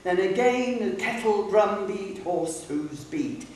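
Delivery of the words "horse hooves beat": usual speaking voice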